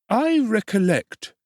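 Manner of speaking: high, slow, fawning undertaker voice; high ingratiating tradesman; oily undertaker. Acted.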